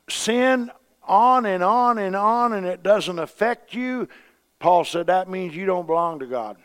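loud, driving revival preaching; preaching at full force. Acted. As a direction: pound it home from the pulpit, slowing on the warning.

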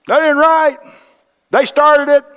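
put-on very high, loud childish protest; hammed-up tantrum voice; foot-stomping whine. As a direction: whine like a kid stamping his foot. Arguing back with the Lord.

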